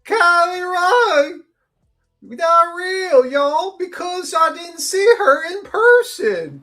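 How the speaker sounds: put-on high, slow, batshit-crazy Southern accent. Co-host mocking the defendant's testimony, crazy Southern accent. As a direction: wail it in an over-the-top bad Southern accent. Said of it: "two hours of my shitty Southern accent".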